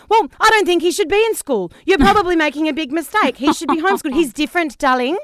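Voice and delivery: mother on the phone, overdramatic and fussing; drama queen mum. Retold or imitated. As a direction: ham up a theatrical mum. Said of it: "the biggest drama queen on earth".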